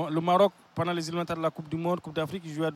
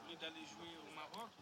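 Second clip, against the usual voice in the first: crackling, cutting-out mic; loose cable crackle; 25 dB quieter than usual. Real, not acted. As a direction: voice dropping out through a bad cable. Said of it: "il y a un faux contact".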